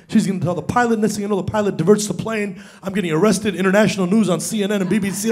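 normal talking voice

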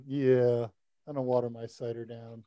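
ordinary talk, nothing marked

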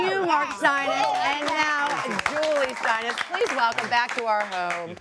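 grand talk-show introduction; announcer intro. Acted. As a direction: welcome the guest like a TV host. Host introduces guest announcer-style.

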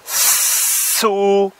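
slow "so" with a long hiss; stretched so. Shown as a demonstration. Teacher sounding out S plus O. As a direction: hold the S before "so". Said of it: "ça va faire".

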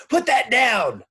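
sharp high loud yell; teacher yelling at a pupil for touching her notebook. A narrator's reading. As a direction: snap out a loud command. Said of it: "yelled Missus Drizzle".

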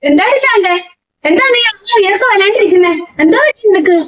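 high, startled questions; alarmed concern. Acted. Friend finds Shanta sweating and asks in high alarm. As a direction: rush in worried and startled.

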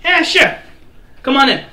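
high, loud easy welcome; dwarf bouncer voice. Acted. Dwarf bouncer letting the party in, high and loud. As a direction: wave them in casually. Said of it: "He goes".

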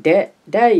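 high, loud shout of "Daddy"; child's shout. A narrator's reading. Ellie calling to her father across the jetway, loud. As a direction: call out loud and high with joy. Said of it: "when Ellie shouted".

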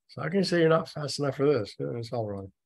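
ordinary talk

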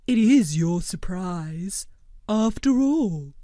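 slow, earnest blob creature voice; gentle, measured cartoon creature; Blobbert voice. A narrator's reading.

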